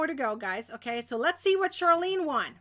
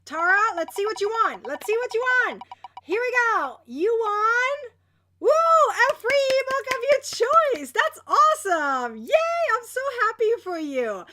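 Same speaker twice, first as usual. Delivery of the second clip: loud, high, building to a whoop; excited prize reveal; 7 semitones higher, 7 dB louder than usual. Real, not acted. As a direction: build suspense then cheer the winner.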